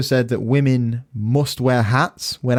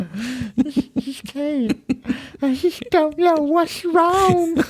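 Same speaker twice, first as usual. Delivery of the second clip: high, slow, laughing wail; mock distressed voice; much slower than usual.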